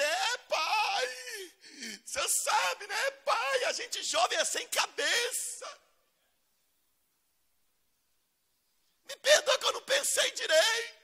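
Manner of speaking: high, loud, whiny excuses; pleading son. Retold or imitated. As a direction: beg like a sulky teenager caught out.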